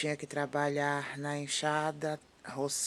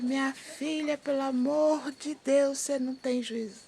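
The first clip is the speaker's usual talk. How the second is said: imitating dad's high-pitched scolding; high, mock-alarmed parental reproach; exasperated father; 10 semitones higher than usual. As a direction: plead with your reckless daughter, voice pitched up. Father scolding child in retold exchange.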